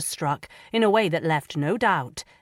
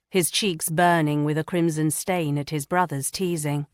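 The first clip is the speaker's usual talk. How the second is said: usual speaking voice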